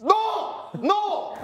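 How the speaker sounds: sudden high shouted denial. Real, not acted. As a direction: yell it in disbelief. Sister protests wrong sibling names.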